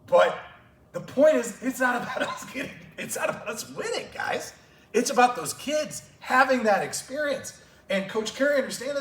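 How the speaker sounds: choked-up passion breaking through; getting emotional. Real, not acted. Coach to coaches, overcome with feeling, emotional. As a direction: voice pushed high and loud with emotion. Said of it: "I'm sorry, I get emotional".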